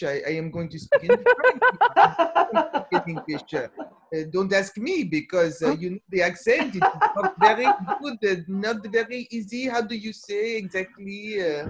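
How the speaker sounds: laughing English with a heavy French accent; hammed-up French accent, giggling through it; English in a thick French accent between laughs. Shown as a demonstration. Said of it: "en anglais avec l'accent français".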